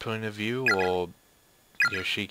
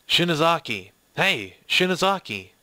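raised, louder call to get attention; 5 semitones higher, 5 dB louder than usual. Acted. Character calls out to Shinozaki, urgent raised voice.

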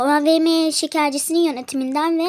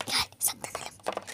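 whispering; 11 dB quieter than usual. Real, not acted. Hiding from the worker.

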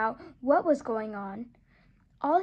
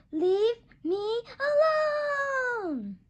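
much higher, drawn-out wailing shout; crying shout; 9 semitones higher, 4 dB louder, much slower than usual. Acted. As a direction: wail it out while running away. Duck flees pursuing animals.

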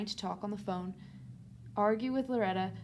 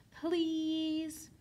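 long drawn-out, higher-pitched plea; pleading child; 7 semitones higher than usual. A narrator's reading. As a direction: beg like a child wheedling mum. Child begs mother to agree.

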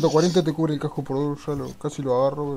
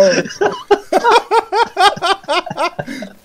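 high ringing laughter; long high laugh; 6 dB louder than usual. Real, not acted. Long laugh after grenade joke.

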